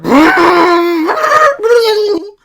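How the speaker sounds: loud, high shouted whoosh of the bobsled; loud imitated whoosh. Teller imitates the bobsled arriving with a loud high whoosh. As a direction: belt out the noise of the sled coming.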